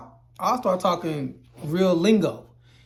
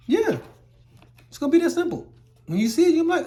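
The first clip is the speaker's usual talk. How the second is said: excited; 11 semitones higher, faster than usual. Real, not acted. Excited reveal of simple answer.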